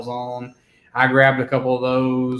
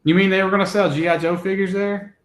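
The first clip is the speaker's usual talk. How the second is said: mock surprise; 7 semitones higher, 4 dB louder, faster than usual. Mock surprise at toy show.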